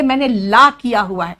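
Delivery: ला with open aa, for "law"; open-mouthed aa on ला; Allahabad-style pronunciation. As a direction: say ला as "laa", mimicking regional speech. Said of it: "ला नहीं होता".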